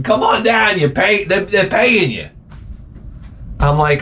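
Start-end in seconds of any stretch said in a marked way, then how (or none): 0.0-2.3 shrill translation shout. Retold or imitated. Comic translates the lawsuit ad into a shrill mock yell. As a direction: blurt out what the ad really means, yelling high.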